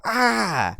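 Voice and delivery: roommate's scream of release, high and loud; retold scream. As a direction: imitate the roommate finally yelling it out. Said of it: "he screamed as loud as he could".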